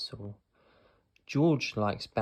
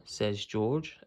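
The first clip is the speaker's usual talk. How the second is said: usual speaking voice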